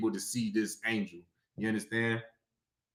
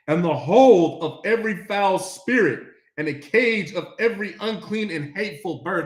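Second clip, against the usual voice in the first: louder and higher preaching read; scripture reading; 6 semitones higher, 4 dB louder than usual. Acted. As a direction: declaim the verse again. Reads scripture aloud.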